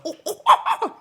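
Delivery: high, laughing little yips of a squirrel barking; playful high-pitched mock squirrel bark; squirrel bark imitation. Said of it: "they kinda, like, bark".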